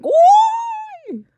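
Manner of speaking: high loud whoop; loud high-pitched celebratory cheer; excited cheer. Real, not acted. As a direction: let out a big joyful woo. Cheering after the scare.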